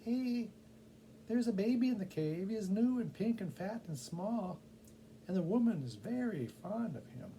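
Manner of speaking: excited tiny bat bringing gossip; high squeaky bat. A narrator's reading. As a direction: play the little bat, eager with news. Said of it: "Bat said".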